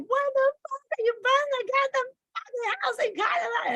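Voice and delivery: re-enacting her tantrum at God; screaming at God. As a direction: hurl the question up at God in rage. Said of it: "I was, like, screaming at God".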